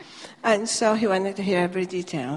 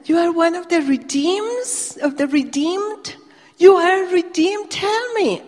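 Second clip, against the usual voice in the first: much higher and louder, pleading in wonder; climbing higher with each question, thrilled; rising excitement; 9 semitones higher, 9 dB louder than usual. Retold or imitated. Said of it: "his pitch just went up with excitement".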